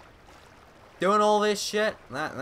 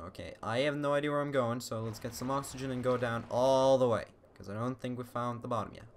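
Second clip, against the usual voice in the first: ordinary talk, nothing marked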